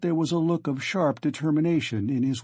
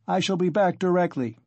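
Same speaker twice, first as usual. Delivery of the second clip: shout; 5 semitones higher than usual. A narrator's reading. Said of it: "he shouted to the horror-stricken mother".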